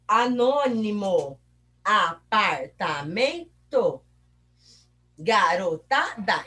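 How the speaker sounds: ANÔNIMO, APARTAMENTO, GAROTADA slowly broken up; slow counting-out of every syllable in ANÔNIMO, APARTAMENTO, GAROTADA. Shown as a demonstration. Teacher splitting longer examples into syllables.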